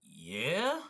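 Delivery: drawn-out questioning yeah, rising; retold answer as if it is obvious; incredulous reply. Worker answers the nosy stranger, retold incredulous yeah. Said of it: "I'm like".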